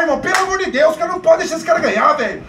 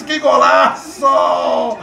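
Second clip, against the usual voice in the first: drawn-out goal cry; 10 semitones lower, much slower than usual. Real, not acted.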